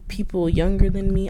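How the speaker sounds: ordinary talk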